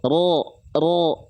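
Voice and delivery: low heavy "Ro" with O-like vowel and no pout; thick ro, unpouted. Shown as a demonstration. Teacher has students watch the mouth, thick unpouted ro. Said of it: "Mulut kita nggak monyong".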